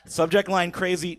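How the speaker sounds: usual speaking voice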